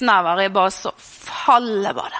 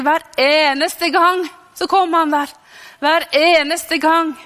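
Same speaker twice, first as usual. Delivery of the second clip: higher, raised voice with insistent conviction; emphatic preaching; 5 semitones higher, 4 dB louder than usual. Real, not acted. Preacher assuring listeners that God comes every time, emphatically. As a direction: proclaim it with force, leaning on each word.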